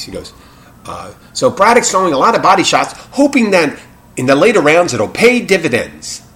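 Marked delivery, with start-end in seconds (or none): 1.3-6.2 high, loud 1930s fight commentator; old-timey radio announcer. Retold or imitated. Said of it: "you gotta think it's an old-timey nineteen thirty voice".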